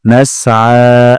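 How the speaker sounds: "নাসআ" ending in a drawn throaty আ; very slow, pull the আ from mid-throat; long throaty aa. Shown as a demonstration. Teacher demonstrating the throat sound. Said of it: "এক আলিফ টান গলার মাঝখান থেকে".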